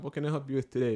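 ordinary delivery

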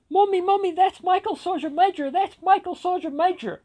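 put-on very high, very loud child's squeal; a young daughter loudly calling to her mother; excited little girl. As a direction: shout it like an excited small child tugging at mom. Little girl spotting him excitedly.

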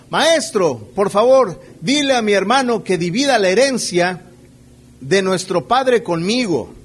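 loud pleading call from the crowd; exclaimed plea. Acted. A man in the crowd crying out loudly to the teacher. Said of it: "alguien de la multitud exclamó".

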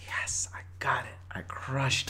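whispered self-congratulation, hammed up; mock triumphant whisper. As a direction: whisper it like a secret victory. Mimes private triumph after the bit.

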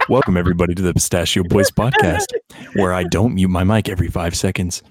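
loud podcast host opener; punchy late night jokes. Pretend. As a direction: deliver it like a host's opening joke. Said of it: "say into the microphone".

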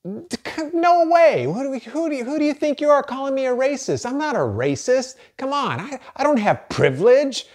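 indignant denial. Retold or imitated. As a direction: play your own old self, offended and protesting. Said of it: "your initial reaction for me is".

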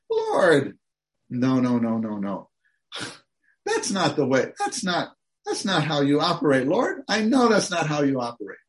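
Abraham pleading with God. Retold or imitated. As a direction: plead like a friend who knows God better. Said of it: "This is how he, he talked to God".

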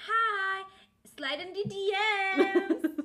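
high, loud sing-song greeting; mock flirty guy. Mocking a contestant's DM tactics, loud high cheesy greeting. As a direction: play a cheesy guy sliding in.

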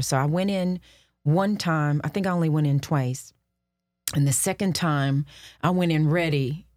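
ordinary talk

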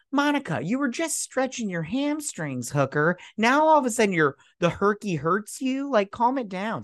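high, exasperated telling-off; incredulous mock rebuke; mock scolding. Mock scolding of film character.

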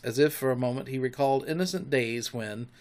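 ordinary delivery

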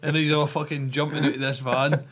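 ordinary delivery

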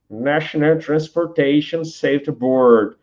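slow, careful American English; American accent. Shown as a demonstration. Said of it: "um sotaque americano".

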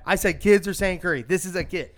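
loud, high, emphatic repeat; heated insistence. Real, not acted. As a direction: hammer the point home loudly. Insists over friends' objections.

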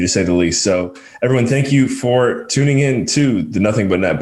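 upbeat host sign-off; podcast outro. Acted. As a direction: wrap up the show like a host.

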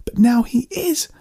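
very high, drawn-out shriek; high, anguished retort; accusing shriek. A narrator's reading. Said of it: "Amanda shrieked".

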